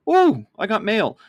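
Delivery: high, loud mock delight; gleeful, hammed-up thrill at getting post; excited about mail. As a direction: squeal over a letter like a kid. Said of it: "you're like".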